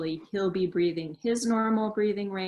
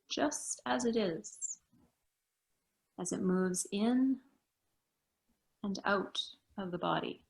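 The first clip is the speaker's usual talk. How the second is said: guided meditation. Acted. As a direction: guide them gently into stillness. Leads a meditation.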